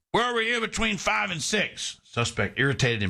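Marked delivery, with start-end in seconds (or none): 0.1-2.0 high-pitched cop's question, lightly acted; questioning police officer. Police officer to his suspect in a joke, high-pitched questioning.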